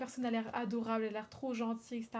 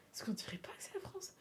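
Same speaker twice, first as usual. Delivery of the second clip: whisper; 8 semitones higher, 10 dB quieter than usual. Real, not acted. Whispered aside.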